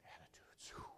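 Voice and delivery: unvoiced-sounding, very soft whisper. Real, not acted. Preacher naming the third point, whispered for effect. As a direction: drop the word to a whisper.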